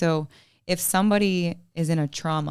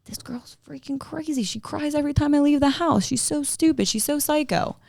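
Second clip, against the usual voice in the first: high, hammed-up scornful voice, starting in a whisper; mocking the husband's thoughts about his crying girlfriend, high and scornful; mock husband's inner voice; 9 semitones higher than usual. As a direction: sneer it like a dismissive boyfriend.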